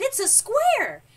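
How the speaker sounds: high, loud, drawn-out announcement; thrilled shout of discovery; jubilant holler. A narrator's reading. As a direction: holler your discovery slowly and proudly. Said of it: "he hollered".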